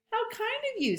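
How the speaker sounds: high, sweet thanks; high grateful Rabbit. Acted.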